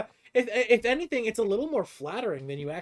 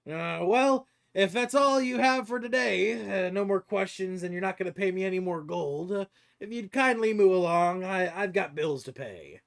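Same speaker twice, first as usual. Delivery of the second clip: raised-pitch, brisk-mannered brush-off; businesslike dwarven stranger; 4 semitones higher, 4 dB louder than usual. Acted. [name] sends the party off to get back to customers, dismissive. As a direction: shoo them along in the higher character voice.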